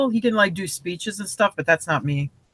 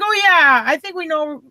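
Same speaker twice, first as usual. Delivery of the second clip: higher-pitched mock "oh yeah"; retold recognition; 5 semitones higher than usual. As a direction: lightly act out their dawning recognition. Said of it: "they're like".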